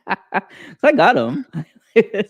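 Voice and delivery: slow, laughing through the words. Real, not acted. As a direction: laugh out the line. Laughing at his own remark.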